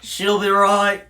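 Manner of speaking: loud, drawn-out cartoon Aussie; milk every Australian cliché at full volume; Australian accent. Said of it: "I'm using every cliche I can think of".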